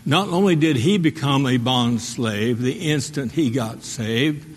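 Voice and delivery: normal talking voice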